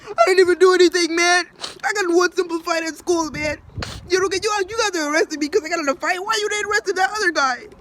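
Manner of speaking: high, fast whiny protest; hammed-up crying kid pleading his case; mock boohoo crying kid. Imitating the crying kid protesting his arrest, high and whiny. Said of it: "over there boohoo crying".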